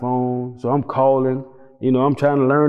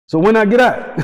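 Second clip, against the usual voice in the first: higher, louder eager question; 9 semitones higher, 5 dB louder than usual. Retold or imitated. To the attorney about release, high and eager. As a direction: ask it bursting with hope.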